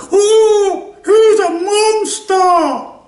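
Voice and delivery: very high, loud, slow shrieking outrage; mock horrified shriek. Mocking people who shriek at a killer, very high and loud. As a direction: shriek it like a scandalised crowd. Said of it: "When people go".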